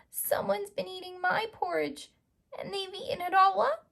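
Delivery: very high little bear cry; baby bear wailing over his empty bowl; teeny tiny Baby Bear voice. Acted. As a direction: squeak it like a tiny cub. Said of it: "in his teeny tiny voice".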